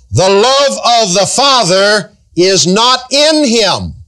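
slow, lofty recitation of the verse; solemn scripture reading. Acted. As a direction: intone the Bible verse with weight.

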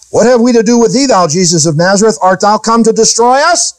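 the demon pleading through the man's voice; demon crying out. Acted. Demon cries out through man.

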